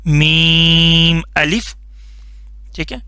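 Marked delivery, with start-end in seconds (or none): 0.0-1.7 slow letter demo. Shown as a demonstration. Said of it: "simple سا لفظ ہے".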